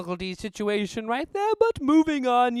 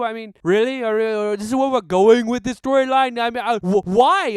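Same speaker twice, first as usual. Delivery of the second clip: calm turning angry; 8 dB louder than usual. Real, not acted. Rant about Batman quitting.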